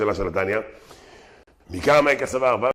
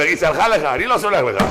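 loud, raised-pitch comic protest; mock indignant refusal; 5 semitones higher, 6 dB louder than usual. Husband refusing pardon to wife's old flame, mock outraged. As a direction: refuse loudly as the aggrieved husband.

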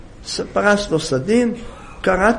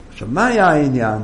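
normal talking voice

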